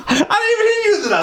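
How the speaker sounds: fast, high, laughing. Real, not acted. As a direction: blurt it while cracking up.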